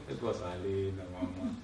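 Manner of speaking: soft, low, tender answer; tender reply. Retold or imitated. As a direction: say it gently to your mother. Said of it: "son answers dying mother tenderly". (respuesta tierna y suave a la madre)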